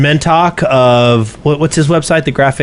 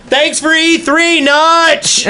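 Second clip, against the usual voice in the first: from another room; 13 semitones higher, slower than usual. Pretend. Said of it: "do we wanna say".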